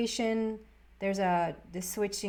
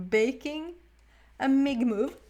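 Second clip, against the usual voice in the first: amused slip; 5 semitones higher, 3 dB louder than usual. Real, not acted. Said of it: "Or making a big move".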